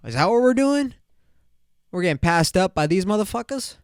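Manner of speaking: mock outrage. Reacting to his friend marrying first, high mock outrage. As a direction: squeak out the disbelief. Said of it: "And I'm just like".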